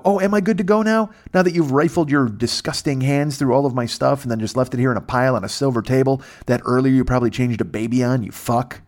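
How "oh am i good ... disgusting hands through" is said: sarcastic retort. Retold or imitated.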